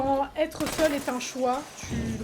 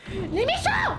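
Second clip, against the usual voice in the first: hyper call; 9 semitones higher, 4 dB louder, slower than usual. Real, not acted. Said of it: "[name] hyperactive".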